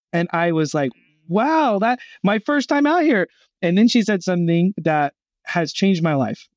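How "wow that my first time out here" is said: amazed. Retold or imitated. Amazed at first conversion.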